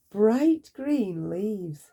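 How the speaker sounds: plain reading voice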